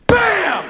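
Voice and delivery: high, very loud bam; explosive imitated impact; punch noise. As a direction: shout the blow as it lands. And he goes.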